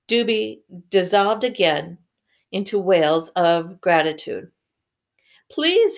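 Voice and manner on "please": high, urgent please; Harry begging the elf to hush, high and urgent; frantic plea. A narrator's reading. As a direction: beg frantically. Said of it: "Harry whispered frantically".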